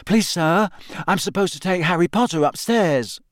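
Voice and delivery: high-pitched eager schoolboy; Colin. A narrator's reading. Pupil asking teacher to release Harry, high and eager. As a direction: pipe up politely in a high boy's voice. Small boy addresses stern teacher.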